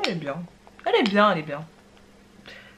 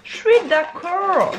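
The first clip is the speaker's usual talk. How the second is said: much higher, louder and slow, a character voice; high put-on voice; 10 semitones higher, 7 dB louder, slower than usual. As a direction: say it as a squeaky agreeing character. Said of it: "même phrase répétée en voix aiguë". (voix de personnage très aiguë et lente)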